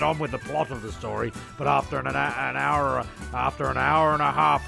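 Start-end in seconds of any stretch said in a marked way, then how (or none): none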